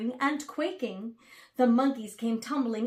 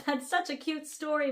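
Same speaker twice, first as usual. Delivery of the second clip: plain reading voice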